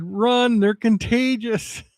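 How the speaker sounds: high-pitched cartoon warning, words stretched out; mock panicked yell. Moon warning the other planets, mock panicked yell. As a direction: sound a comic alarm in a high voice. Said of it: "he's yelling at Mars".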